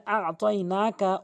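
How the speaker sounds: আতাইনাকা drawn out slowly, each syllable held; slow tajweed recitation. Shown as a demonstration. A Quran teacher recites আতাইনাকা slowly. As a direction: spell out আতাইনাকা slowly for a student. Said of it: "অর্থ হচ্ছে".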